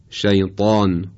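emphatic T and backed vowel in «شیطان»; تلفظ ط با اطباق. Shown as a demonstration. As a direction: say «شیطان» with a heavy velarized ط. («شیطان» با طای پرحجم و مُطبَق)

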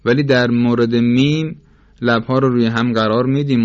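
ordinary talk

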